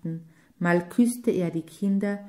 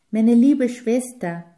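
higher, louder, weeping cry; crying out like a child; 4 semitones higher, 7 dB louder than usual. A narrator's reading. Brother finds his lost sister, crying out weeping. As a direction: sob out his sister's name. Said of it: "rief er aus, wie ein Kind weinend".

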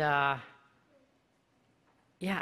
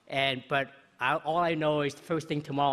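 ordinary delivery

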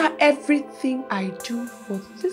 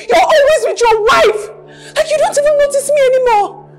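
high, loud sobbing shout, then faster; crying shout; 11 semitones higher, 7 dB louder than usual. Acted. Mistress sobbing at Ebuka about his wife, shouting. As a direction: wail it through tears.

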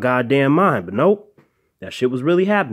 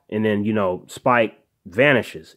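ordinary talk

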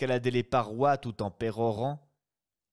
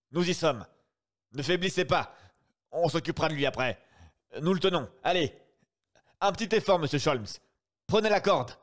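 loud, higher, fast calls; urgent shouting; 6 semitones higher, 4 dB louder, faster than usual. Acted. Brigadier to Sholmes in the water, urgent loud shouts. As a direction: yell him toward the rope. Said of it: "hurla le brigadier".

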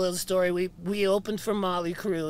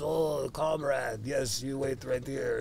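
slow, lower mock Russian accent; Russian roadie; 8 semitones lower, 6 dB quieter, slower than usual. Russian road crew guy promising hash, slow mock Russian accent. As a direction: drawl it like an obliging Russian roadie. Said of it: "this one Russian dude goes".

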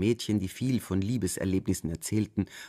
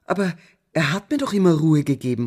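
higher, louder protest; cry out; 6 semitones higher, 10 dB louder than usual. A narrator's reading. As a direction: call out in protest. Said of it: "rief ich". (Tochter protestiert laut und höher)

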